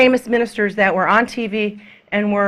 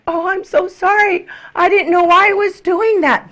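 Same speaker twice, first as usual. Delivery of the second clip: higher, hammed-up sorry voice; mock apologetic pastor; 9 semitones higher than usual. Preacher imitating the angry pastor apologizing afterward, mock sorry. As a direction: play the sheepish husband saying sorry. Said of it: "they're like".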